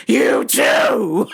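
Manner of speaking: loud fake-cheery shout; mock shouted farewell. Comic shouting a fake-cheerful goodbye at the guards.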